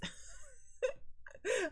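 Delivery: high squealing laugh; real high-pitched laughing fit. Real, not acted. After cookies joke.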